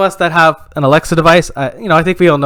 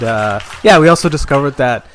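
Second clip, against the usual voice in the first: usual speaking voice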